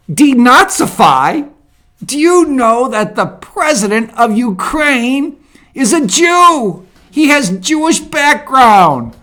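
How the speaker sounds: loud, high-pitched disbelief; incredulous outburst. Real, not acted. Commentator rebutting Putin's claim about Ukraine, loudly incredulous.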